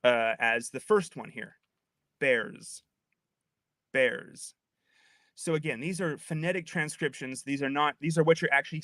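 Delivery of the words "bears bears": BEARS ending in a buzzing Z; standard American plural. Shown as a demonstration. Demonstrating the usual American plural, BEARS with a Z ending. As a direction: say BEARS with a voiced z at the end. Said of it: "most Americans would pronounce".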